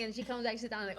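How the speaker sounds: ordinary talk, nothing marked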